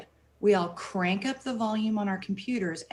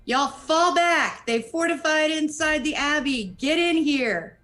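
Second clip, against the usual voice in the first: shouting; 6 semitones higher, 5 dB louder, slower than usual. Acted. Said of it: "go ahead and shout to my companions".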